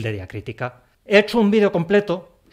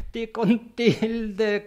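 ti con tilde drawn out high and very slow; mock pained wail; 6 semitones higher, much slower than usual. Teacher to viewers, moaning in mock pain at the error. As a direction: moan it like it hurts. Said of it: "qué daño me hace".

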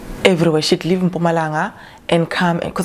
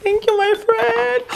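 gushing thanks; 14 semitones higher than usual. Real, not acted. Emotional thanks for a gift.

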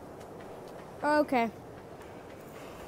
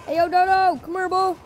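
loud, higher call to the dodo; 8 semitones higher, 8 dB louder than usual. Real, not acted. Player calling a dodo over, loud and high. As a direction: yell it across to the bird.

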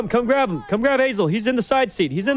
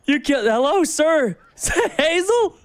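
higher, laughing disbelief at the crash; shocked excited outburst; 10 semitones higher than usual. Real, not acted. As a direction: react to the crash in giddy shock. Friend hit by car on road.

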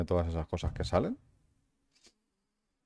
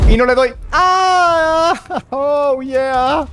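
very high, loud cry; excited shriek; 10 dB louder, much slower than usual. Real, not acted. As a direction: squeal at the miss. Misses shot, cries out. (chillido de emoción)

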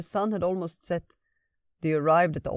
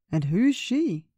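put-on higher, louder curious old bear; 6 semitones higher, 6 dB louder than usual. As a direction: ask with friendly curiosity, pitched up. Old Bear asks about Tina.

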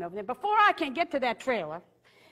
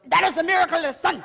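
high, fast, loud scolding yell; yelling woman; 6 semitones higher, 8 dB louder, faster than usual. Retold or imitated. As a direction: blast it at a stranger. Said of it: "Like she yells at me".

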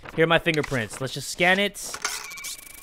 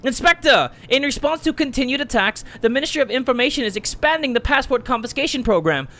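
high, proud state announcer building to a shout; ministry official voice; 9 semitones higher than usual. Acted. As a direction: proclaim it like a propaganda herald. Reading ministry bulletin in voice.

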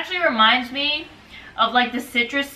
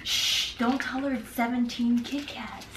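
conspiratorial hush; 8 dB quieter than usual. Real, not acted. Secret aside about the Kit Kats.

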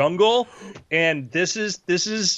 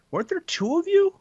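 questioning player; 12 semitones higher than usual. Acted. Game master voices a player asking.

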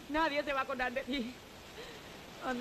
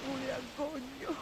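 broken; 9 dB quieter, slower than usual. Acted. Wife alone, devastated.

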